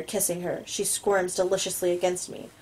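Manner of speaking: ordinary delivery, nothing marked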